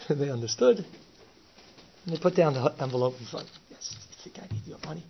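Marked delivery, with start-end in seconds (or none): 3.7-5.0 hushed, scornful whisper; a whispered brush-off of the money; dismissive whisper. Retold or imitated. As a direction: toss the words off under your breath. Mafia guy refuses the bribe.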